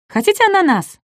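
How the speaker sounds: very high, hopeful offer; eager little dinosaur. Acted. Ком с надеждой предлагает ананас. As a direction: offer it brightly.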